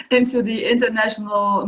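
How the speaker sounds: laughing through the words; a real laugh spills into the question. Real, not acted. Host laughing as she asks her guest.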